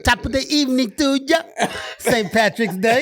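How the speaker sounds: a hammed-up Irish brogue, pitched high; a playful, over-the-top Irish greeting; bad mock Irish accent. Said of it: "My best Irish accent I can give".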